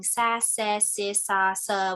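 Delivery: each syllable sounded out slowly and separately; phonics blending. Shown as a demonstration. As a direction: sound out Sa, se, si, sa, sa like a preschool teacher. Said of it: "we just did some blending".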